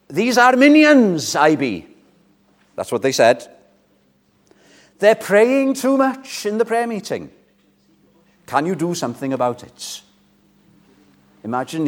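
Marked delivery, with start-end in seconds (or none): 0.1-10.0 higher, mock indignant complaint; complaining ministers. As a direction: whine like fussy ministers. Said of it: "They would say to him".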